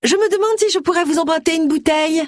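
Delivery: high-pitched village busybody; pushy Gloria, wheedling. Acted. Neighbour angling for sherry at a widower's door, high and wheedling. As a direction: put on a high, sing-out voice to cadge sherry. Voices Gloria asking a favour.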